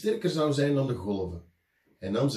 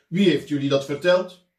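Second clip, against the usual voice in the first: stern teacher; 4 semitones higher, 9 dB louder than usual. A narrator's reading. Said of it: "riep de meester streng".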